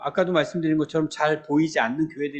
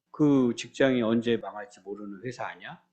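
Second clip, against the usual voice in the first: low, softer voice of a worrying parent; 걱정하는 부모 흉내; 6 semitones lower, 6 dB quieter than usual. Acted. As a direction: fret quietly, as an anxious parent would. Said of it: "이러면서 걱정을 하기 시작해요". (걱정스럽게 낮춘 부모 목소리)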